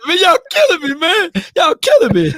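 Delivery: high-pitched teasing cry; playful high exclaim. Retold or imitated. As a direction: squeal it out in mock exasperation. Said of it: "I gonna turn to R. Kelly over here".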